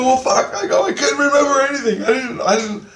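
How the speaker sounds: high, fast, loud protest; retold high-pitched, stammering plea; pleading his case. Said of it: "I'm pleading my case".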